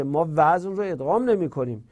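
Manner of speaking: usual speaking voice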